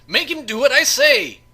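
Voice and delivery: high, loud whining demand; whine. Acted. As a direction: whine like a spoiled child demanding his way. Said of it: "The voice shifted to a whine".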